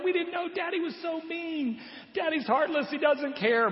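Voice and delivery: high, wailing child's complaint, mock tearful; crying kids. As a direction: whine like a heartbroken little kid. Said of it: "and they're crying".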